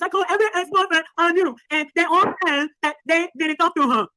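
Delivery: put-on caricature of her speech; mocking voice impression. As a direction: mimic her, fast at first, to ridicule her. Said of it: "you make her sound like".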